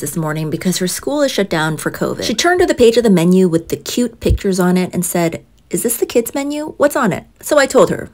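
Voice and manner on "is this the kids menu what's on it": put-on little girl voice. Daughter voiced at breakfast.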